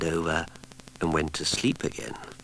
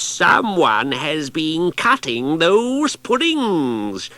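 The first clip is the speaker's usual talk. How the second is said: higher, louder slow wailing growl; fierce witch voice; 9 semitones higher, 9 dB louder, much slower than usual. Acted. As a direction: menace the hidden thieves. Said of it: "in a fierce voice".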